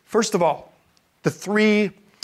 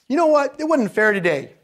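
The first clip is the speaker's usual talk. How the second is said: high, loud, indignant schoolgirl; venting daughter; 7 semitones higher, 4 dB louder than usual. Acted. Daughter telling parent about her day, indignant. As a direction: play a daughter launching into a complaint. Daughter voiced coming home to vent.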